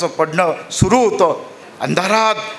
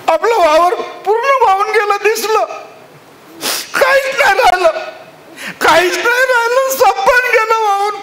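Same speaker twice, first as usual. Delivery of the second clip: crying husband. Acted. Farmer sobs to his family about his ruined field. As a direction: cry out the lost field.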